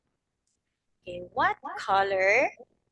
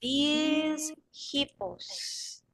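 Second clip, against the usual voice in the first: slow, drawn out; 4 semitones higher, 3 dB quieter, much slower than usual. Shown as a demonstration. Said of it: "read this like a bee that buzz".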